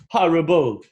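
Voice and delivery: high bright horrible with open ah; stage standard horrible, first vowel open; British-style horrible; British-leaning horrible. Shown as a demonstration. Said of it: "So horrible".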